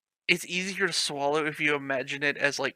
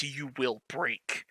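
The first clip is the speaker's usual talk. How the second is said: softer, slow ominous warning; 7 dB quieter, much slower than usual. Acted. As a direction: hush it out slowly like a threat. Reading ominous line.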